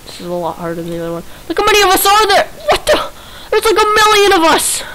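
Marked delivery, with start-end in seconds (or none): 1.5-4.8 surprised shout. Real, not acted. Surprised at crowd.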